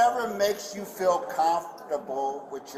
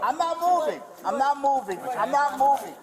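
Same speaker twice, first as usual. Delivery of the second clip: defiant; 8 semitones higher than usual. Real, not acted. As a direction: plant your feet and refuse. Refusing arrest, repeated.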